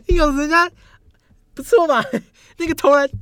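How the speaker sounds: high squealing laughter; laughing. Real, not acted. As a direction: squeal through the words. Laughing high excited plea.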